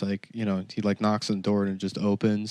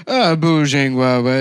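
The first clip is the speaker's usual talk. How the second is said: loud, higher mock Chinese; drunken fake Chinese; 4 semitones higher, 7 dB louder, slower than usual. Trashed man back from the bathroom at dinner, fake Chinese. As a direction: blurt nonsense Chinese loudly. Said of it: "he's, like, speaking Chinese".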